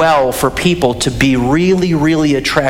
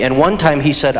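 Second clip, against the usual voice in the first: normal talking voice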